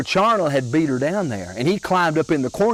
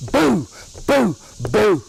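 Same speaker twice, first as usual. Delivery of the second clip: repeated hollers; 7 semitones higher, slower than usual. Retold or imitated. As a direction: keep jumping out and yelling. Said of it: "jumped out and hollered".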